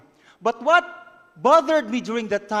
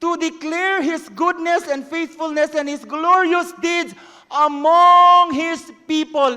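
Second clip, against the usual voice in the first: high, loud, slowing proclamation; preaching at full force; 7 semitones higher, 8 dB louder than usual. Acted. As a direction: build to a loud finish. Preaching climax.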